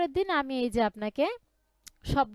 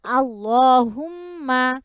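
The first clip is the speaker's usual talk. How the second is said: recitation for repeating; 8 dB louder than usual. Shown as a demonstration. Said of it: "বলেন".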